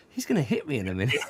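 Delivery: fast, nervous inner thought; quick worried thought. Retold or imitated. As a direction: rush it like a panicked aside. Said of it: "I was thinking".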